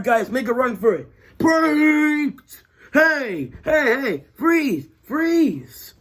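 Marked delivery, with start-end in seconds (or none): 1.4-2.6 high mocking cheer; taunting. Taunting the guards. 2.9-5.9 high commanding guard shout; guard shouting freeze. Acted. Guard ordering prisoners to stop.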